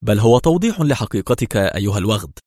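ordinary delivery

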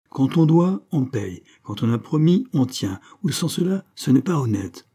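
«on» and «promis» pushed up high with a rising lilt; each rule hammered out, the first half of each rising high; stressed maxim. A narrator's reading. Madame Sainte-Juste lays down the law, high stressed rises. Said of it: "conclut par ces mots".

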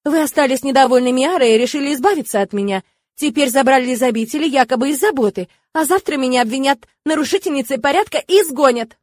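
loud, agitated protest. Acted. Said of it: "повысила голос я".

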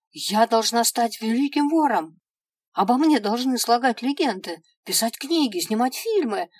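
high, loud, indignant pleading; indignant protest. Acted. Dead girl arguing with the imp, indignant and loud. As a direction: rant like a cheated would-be legend.

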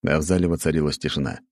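ordinary delivery

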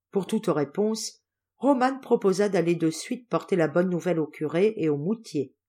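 ordinary delivery

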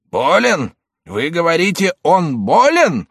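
high, loud and slow outraged cry; exclaiming. A narrator's reading. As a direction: throw the word back in disbelief. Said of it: "воскликнул".

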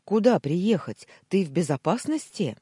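urgent. A narrator's reading. Former boss ready to come to her, urgent. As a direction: fire off worried questions.

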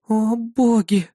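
slow, shaky hushed gasp; trembling whisper. A narrator's reading. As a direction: breathe it out in shaky awe. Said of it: "прошептала она дрожащим голосом".

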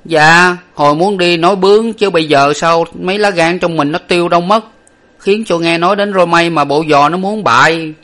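high, loud servant protesting his fear; high-pitched servant whine of excuse; Lục Tặc's cowed servant voice. A narrator's reading.

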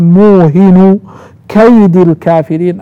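"موهن" as muuhinu without final n, "كيد" as kaydi; Hafs reading. Shown as a demonstration. Teacher showing students Hafs's reading, muuhinu kaydi for "موهن كيد". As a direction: link "موهن" unnunated into "كيد" ending in i. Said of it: "القراءة الثالثة قرأ حفص عن عاصم".

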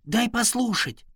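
high, annoyed plea; shushing, fed up; exasperated. A narrator's reading. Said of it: "не выдержал домовой".

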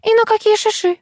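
very high incredulous grilling; insistent. A narrator's reading. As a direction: press him with a high-pitched challenge. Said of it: "не отставала Лариса". (настойчиво, высоким голосом)

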